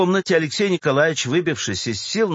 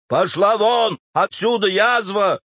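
higher, voiced angry order; furious hiss; 6 semitones higher, 4 dB louder than usual. A narrator's reading. Clerk orders the old woman out, furious. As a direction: throw her out with rising anger. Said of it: "прошипел Алексей Николаевич".

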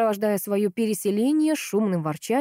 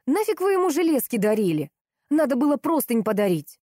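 higher-pitched irritated reproach; раздражённый выговор; 6 semitones higher, 3 dB louder than usual. A narrator's reading. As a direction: scold with rising pitch. Сестра зло выговаривает брату. (раздражённо отчитывая)

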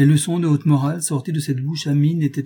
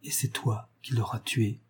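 much softer, nearly hushed; quiet accusation; low voice; 8 dB quieter than usual. A narrator's reading. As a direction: let it sink to a low murmur. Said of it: "fit Lucile à voix basse".

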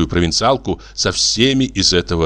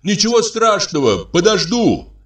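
high call through a closed door; from another room; 12 semitones higher than usual. A narrator's reading. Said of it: "а вслух выкрикнул".

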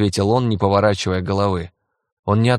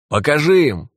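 higher, louder shout; 6 semitones higher, 4 dB louder than usual. Acted. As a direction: shout the order out. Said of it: "прокричал Кёртис".

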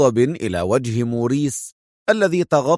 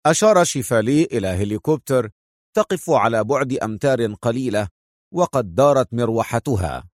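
plain reading voice

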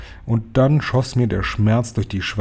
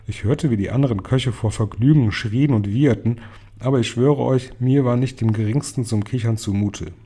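usual speaking voice

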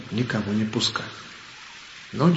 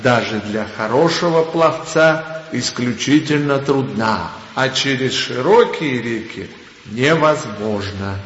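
reading out regulations; 2 semitones higher, 3 dB louder, slower than usual. Pretend. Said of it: "наставлении было сказано".

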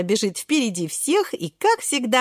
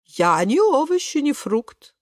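slow, higher-swooping character voice; stranger in the river; 6 semitones higher, slower than usual. A narrator's reading. Salmon correcting Шпинатик, slow put-on voice. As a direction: slowly correct them in a put-on voice. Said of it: "говорит незнакомец".

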